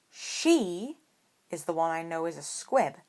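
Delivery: pointed, leaning on She. A narrator's reading. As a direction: hit She hard to make the point. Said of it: "Harry replied pointedly".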